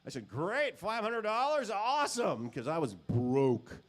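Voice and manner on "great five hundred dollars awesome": much higher, overly chipper acceptance; forced enthusiasm. Retold or imitated. To the producer, accepting five hundred dollars, overly chipper. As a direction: gush like it's a great deal. Accepting a lowball pay offer.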